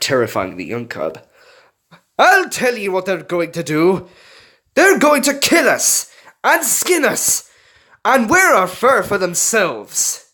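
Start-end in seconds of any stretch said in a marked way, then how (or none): 2.2-10.3 loud, cruel high-pitched fox voice, slow on the threat; bellowing old fox. A narrator's reading. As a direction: frighten the cub with how they'll be skinned. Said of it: "the older red fox bellowed out".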